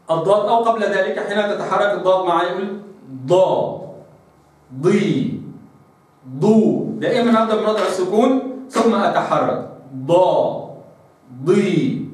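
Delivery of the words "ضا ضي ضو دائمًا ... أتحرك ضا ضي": ضا ضي ضو each starting from a held ض; dad with vowels drill. Shown as a demonstration. Teacher drilling dad with each vowel. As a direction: start on a still ض then open. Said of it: "هكذا".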